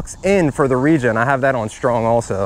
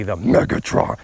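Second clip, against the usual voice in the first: Megatron voice; 7 semitones lower than usual. Pretend. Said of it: "I'm gonna be practicing that voice".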